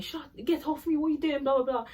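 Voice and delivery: high, heated mock yell; angry shouting. Chloe yelling at Millie during the fight, imitated high and heated. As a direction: imitate a girl losing her temper. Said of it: "all you can hear is Chloe saying".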